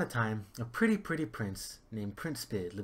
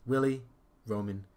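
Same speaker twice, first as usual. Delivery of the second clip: ordinary delivery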